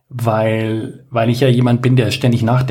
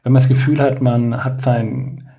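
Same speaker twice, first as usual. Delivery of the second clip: ordinary talk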